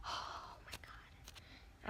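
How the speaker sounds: slow hushed whisper. Real, not acted. Whispering to viewers in quiet awe. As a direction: breathe it out in awe. Whispered gasp over a squishy.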